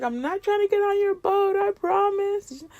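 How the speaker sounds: high, softened pleading voice; pleading promise. Retold or imitated. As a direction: plead your innocence, high and stretched at the end. Acted plea to boat people.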